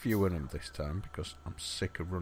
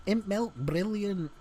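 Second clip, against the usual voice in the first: high, slow put-on Liverpool accent from an old advert; a clumsy Liverpool accent, much higher and drawn out; crap Liverpool accent; 11 semitones higher, slower than usual. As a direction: ham up a bad Liverpool accent, like the Imp milk ad. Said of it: "a crap attempt at a Liverpool accent".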